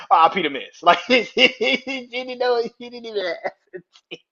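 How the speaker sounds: high voice giggling through the words; real laughter breaking up speech; laughing. Real, not acted. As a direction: keep talking while cracking up. Laughs through mock farewell.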